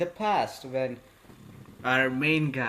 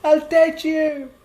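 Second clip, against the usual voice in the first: high, loud, drawn-out declaration; imitated shouted surrender, pitched way up; emphatic yell; 13 semitones higher, 9 dB louder, slower than usual. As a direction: yell it slowly at the top of your voice. Retold girl finally yells agreement.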